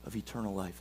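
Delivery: soft, low-voiced reverent quote; Peter's words to Jesus, said hushed and reverent; hushed scripture reading. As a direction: read it gently, almost a prayer.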